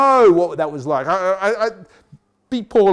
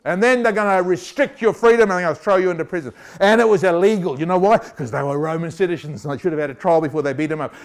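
usual speaking voice

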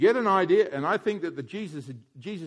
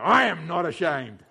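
slower, bold declaration, full voice; with gusto; 4 semitones higher, 8 dB louder, slower than usual. Shown as a demonstration. Preacher leading the crowd, with gusto. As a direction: proclaim it with gusto. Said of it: "say it with gusto now".

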